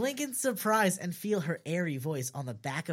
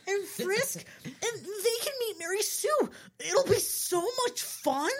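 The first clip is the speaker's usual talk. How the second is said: very high, gleeful squeal; excited screech. Acted. Blueberry wants them to meet Mary Sue, screeching. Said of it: "he screeches".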